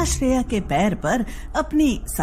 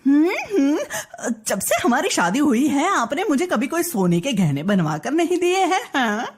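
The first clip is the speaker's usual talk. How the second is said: higher-pitched pouty complaint; wheedling wife; 4 semitones higher than usual. Acted. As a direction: whine for a present.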